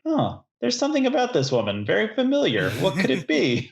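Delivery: high, curious musing; mock puzzled wonder. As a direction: wonder aloud, suspicious. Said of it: "I remember feeling like".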